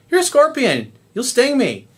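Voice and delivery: high-pitched storyteller's frog objection; wary protest. Acted. Frog refusing scorpion who wants a ride, wary protest.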